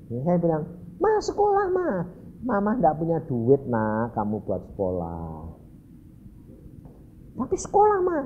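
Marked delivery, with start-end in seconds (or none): 1.0-1.9 put-on high-pitched child begging mother; pleading child. 2.4-5.5 a hint of a resigned mother; mild sorry-to-say refusal to a child; lightly voiced mother. 7.4-8.2 put-on high-pitched child's plea; kid's high wheedling protest; insisting child.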